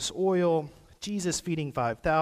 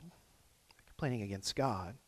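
softer and slower, letting it sink in; quiet repeat; 5 semitones lower, 9 dB quieter than usual. Shown as a demonstration.

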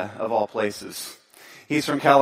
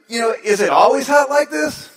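higher, louder eager question; higher keen asking; 8 semitones higher, 5 dB louder than usual. Retold or imitated. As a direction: push to keep the chat going. Speaker pressing for conversation.

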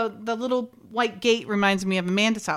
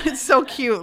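normal talking voice

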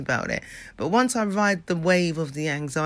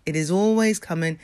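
ordinary delivery

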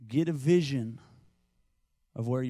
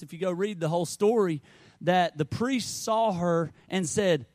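ordinary talk

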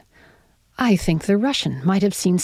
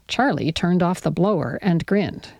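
ordinary delivery

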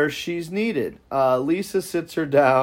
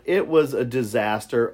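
usual speaking voice

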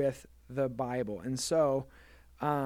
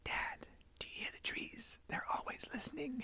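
breathless whisper, pitched up at the end; eerie playful whisper quoting the beavers; child's spooky whisper; 10 semitones higher, 13 dB quieter than usual. Retold or imitated. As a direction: whisper it like a kid spooking a parent. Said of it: "our kids came up to me and said".

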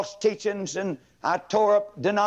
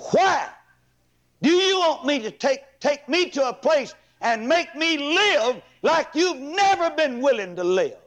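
put-on a girl turning on her father, higher pleading challenge; teen girl's defiant plea; 9 semitones higher than usual. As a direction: fire the question back at her father. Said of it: "she surfaced and said to him".

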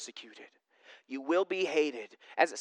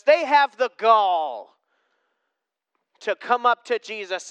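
indignant; 3 semitones higher, 7 dB louder than usual. Real, not acted. Indignant preacher remark.

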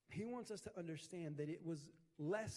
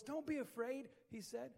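ordinary talk, nothing marked

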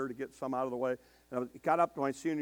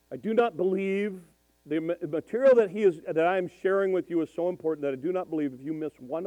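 mock stern professor; 3 semitones higher, 6 dB louder than usual. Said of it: "in his old German accent thing".

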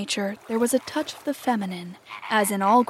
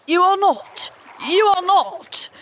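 high, loud, slow denial; hoarse cry; 9 semitones higher, 6 dB louder, slower than usual. A narrator's reading. Hook refutes his impostor, high hoarse cry. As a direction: cry it out twice in desperate denial. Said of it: "Hook cried hoarsely".

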